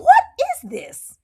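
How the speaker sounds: high, loud disbelieving squeal; squeaky stunned question; incredulous. Real, not acted. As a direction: go way up in shock. Speaker's incredulous reaction.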